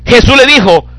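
high, loud preaching over the congregation; fiery preaching. Acted. Preacher reading Jesus's words to his congregation, fiery and loud. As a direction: proclaim it at full force from the pulpit.

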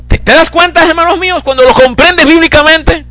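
high, loud preaching over the congregation; fiery preaching. Acted. Preacher reading Jesus's words to his congregation, fiery and loud. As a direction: proclaim it at full force from the pulpit.

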